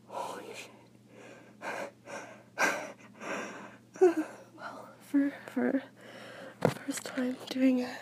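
panting, whispered, out of breath; breathless whisper. Real, not acted. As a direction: gasp it out in a shaky whisper. Breathless whispering right after orgasm.

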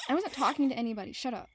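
quick, defensive snap; snapping. Acted. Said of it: "I'm sorry I snapped".

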